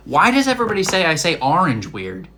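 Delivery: loud, high, mock-offended question; exasperated protest. Real, not acted. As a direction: protest in loud disbelief at being teased. Teased about pronunciation, protests.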